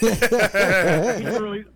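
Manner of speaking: laughing through the words; slow laughing words. Real, not acted. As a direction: crack up mid-phrase.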